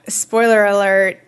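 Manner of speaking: ordinary talk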